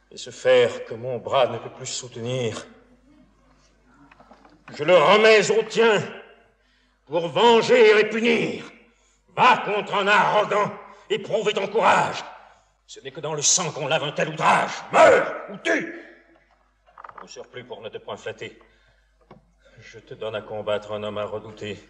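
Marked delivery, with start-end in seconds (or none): 4.7-12.2 loud, high stage exhortation; ringing call to vengeance; commanding declamation. Acted. Father charges son with vengeance. 14.9-16.0 high, loud, drawn-out order; theatrical shouted command; fierce command. Acted. Father orders son to fight. 17.2-21.8 softer, lower confidential stage tone; grave warning. Acted. Father turns to grave warning.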